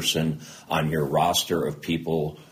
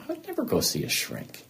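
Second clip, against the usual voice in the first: indignant refusal; 6 dB quieter than usual. Retold or imitated. Retold refusal to see therapist.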